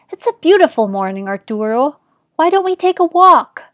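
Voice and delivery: cheery invitation, rising high at the end; coaxing mother. A narrator's reading. Mother coaxes confused father.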